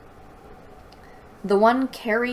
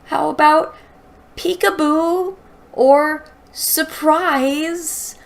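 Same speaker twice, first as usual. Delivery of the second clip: much higher and louder, slow on the alternatives; exasperated rant; 8 semitones higher, 7 dB louder than usual. Real, not acted. Reader ranting at the author about the one-liner, exasperated. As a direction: vent at the author in disbelief.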